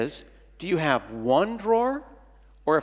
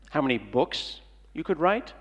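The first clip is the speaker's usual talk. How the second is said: ordinary talk, nothing marked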